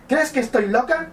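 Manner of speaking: high, sharp woman's voice; man doing a woman's voice. Acted. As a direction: snap back as a woman. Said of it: "voy a poner voz, mm, de mujer".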